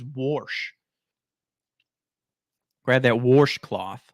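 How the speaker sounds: "warsh" with an added r, drawn out; slow "warsh cloth" with the r; mocking "warsh" for wash. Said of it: "What the fuck is "warsh"?".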